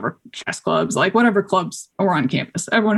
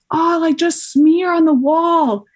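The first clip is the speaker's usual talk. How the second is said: high, slow mock yell; retold yell; 11 semitones higher, 4 dB louder, slower than usual. Onlooker yelling advice up to a stuck climber, mock yell. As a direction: imitate someone calling up with advice. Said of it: "someone yells at me".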